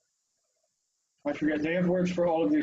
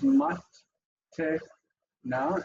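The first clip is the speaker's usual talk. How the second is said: "mat, te, na" in three separate pieces; syllable by syllable; slower than usual. Shown as a demonstration. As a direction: break the word up, pausing after "mat" and "te". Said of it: "it breaks it up as to its, uh, syllables".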